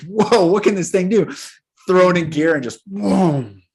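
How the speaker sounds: high, loud excited wow; acted amazement. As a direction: act out thrilled discovery, big and punchy. Acting men thrilled by new power.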